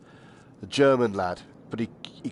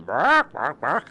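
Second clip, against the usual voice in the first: mock barking; 4 semitones higher, 4 dB louder, much slower than usual. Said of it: "they'd be going".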